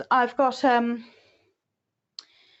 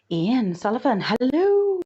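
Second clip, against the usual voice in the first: delighted greeting; 3 semitones higher, 5 dB louder, slower than usual. Real, not acted. Host greeting a viewer on a livestream, delighted. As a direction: greet with a drawn-out, rising whoop on hello.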